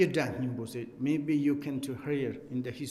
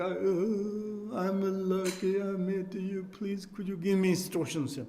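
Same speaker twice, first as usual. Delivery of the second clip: put-on higher, humble old man's voice; old man pleading; 5 semitones higher than usual. Old man to Berotana, humbly pleading. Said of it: "the, uh, old guy".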